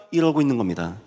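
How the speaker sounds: ordinary talk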